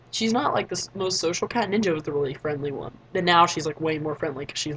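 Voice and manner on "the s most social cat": ordinary delivery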